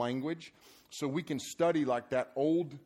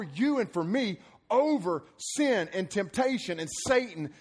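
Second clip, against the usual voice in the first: higher, emphatic preacher's rally; rousing preaching; 7 semitones higher, 4 dB louder than usual. Acted. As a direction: preach victory with driving conviction. Preaches victory through the cross.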